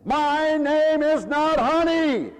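high, loud and slow, every word spaced out; stiff, indignant correction, dragged out; harsh clerk. Retold or imitated. Florist clerk on the phone to the preacher, indignant, loud and slow. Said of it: "She was very harsh".

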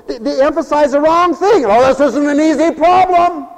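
loud, high, soaring preacher's cry; preaching at full force. Acted. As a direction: preach it out to the whole hall.

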